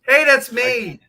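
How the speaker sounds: loudly claims the credit; excited. Real, not acted. Jumps in to claim the message.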